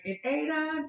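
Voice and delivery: light imitation of a Jamaican teacher's accent. Mom mimics son's Jamaican teacher, lightly Jamaican-accented. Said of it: "in his accent".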